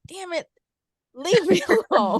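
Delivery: high, laughing mock exasperation; exasperated laugh. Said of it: "but I'm like".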